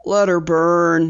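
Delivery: ordinary delivery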